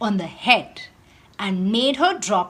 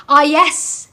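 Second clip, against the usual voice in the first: bright, louder eureka "Ah, yes"; excited cry; 3 semitones higher, 6 dB louder than usual. A narrator's reading. Adil realising what to do with his old shoes, excited cry. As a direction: burst out with the idea. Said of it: "he finally cried".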